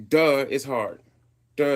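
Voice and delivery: ordinary delivery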